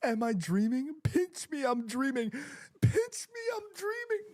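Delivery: very high, squealed disbelief; overjoyed disbelief. Real, not acted. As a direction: squeal it as if you can't believe the goal. Overjoyed disbelief after a goal.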